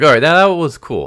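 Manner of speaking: imitating his own thrilled reaction to the comic; excited reaction. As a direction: blurt it out like a fan who just saw something awesome. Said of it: "And I was like".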